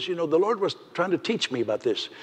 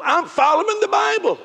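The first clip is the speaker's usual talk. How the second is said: raised, emphatic preaching; 8 semitones higher, 4 dB louder than usual. Real, not acted. Preacher building to a climax over scripture. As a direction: pitch jumps high as he builds.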